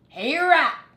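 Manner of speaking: big dog calling out gruffly; barked. A narrator's reading. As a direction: bark it across the yard. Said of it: "barked Dog".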